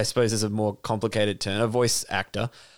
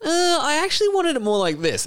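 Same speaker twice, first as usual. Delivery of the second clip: much higher put-on voice; fussy director impression; 14 semitones higher than usual. Director asking the actor to redo an accent, mock fussy. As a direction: imitate a picky director, pitched way up.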